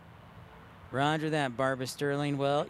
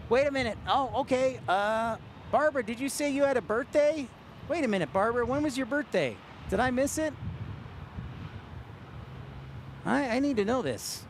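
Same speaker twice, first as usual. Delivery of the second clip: surprised; 7 semitones higher than usual. Real, not acted. Learns a viewer had birthday.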